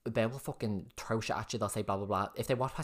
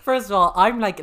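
much higher, louder eager interruption; excited burst; 9 semitones higher, 12 dB louder than usual. Real, not acted. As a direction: cut in loudly, bursting to tell it. Friend jumps in excitedly.

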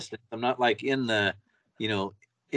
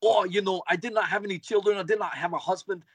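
put-on heavy German accent, a bit higher; 6 semitones higher than usual. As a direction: speak English with a thick German accent. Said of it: "She's got this really heavy German accent".

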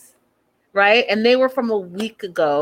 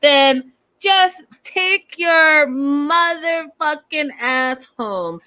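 retold yelling; 5 semitones higher, slower than usual. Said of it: "Angela starts yelling at Natalie".

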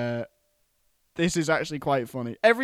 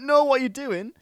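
mock alarm; 10 semitones higher, 7 dB louder than usual. Eddie voiced, alarmed in story.

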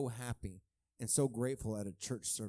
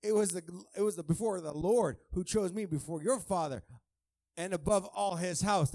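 David's firm comeback, stressing your; pointed retort, louder; 5 semitones higher, 6 dB louder than usual. Retold or imitated. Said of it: "Can you imagine that?".

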